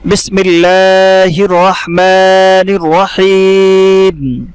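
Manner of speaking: slow, drawn-out chanted recitation; Quranic recitation. Shown as a demonstration. Teacher opening the lesson, slow chanted recitation. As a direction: intone it like tajweed recitation.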